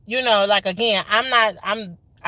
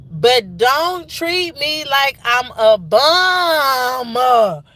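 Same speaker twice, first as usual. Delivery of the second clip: "bum" dragged out long and loud; exasperated plea; 3 semitones higher, 4 dB louder, much slower than usual. Real, not acted. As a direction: draw out "bum" in a protesting wail. Venting after frustrating job interviews.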